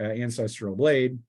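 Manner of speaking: usual speaking voice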